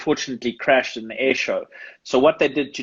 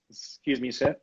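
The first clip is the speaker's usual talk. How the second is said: quiet, polite and formal; polite security guard; 7 dB quieter than usual. Retold or imitated. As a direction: softly ask him to stop, officer-like. Security guards politely stopping him.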